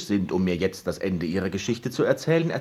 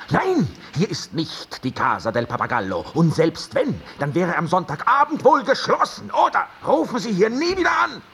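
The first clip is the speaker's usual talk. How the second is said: annoyed on the phone; 11 semitones higher, 3 dB louder than usual. Acted. Snapping at a wrong-number caller.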